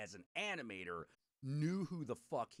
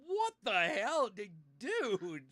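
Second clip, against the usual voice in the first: shocked; 7 semitones higher, 7 dB louder, much slower than usual. Retold or imitated. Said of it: "I was like".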